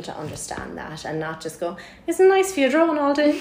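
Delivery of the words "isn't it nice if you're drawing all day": higher and louder, a laughing condescending mimic; mocking outsider. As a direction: ape a patronising stranger through laughter. Mocking people who misunderstand printmaking.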